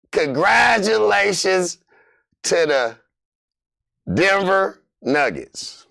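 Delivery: slow, stretched congratulations, team name breathed; announcer-style slow reveal; drawn-out congratulations. Acted. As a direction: savor each word slowly.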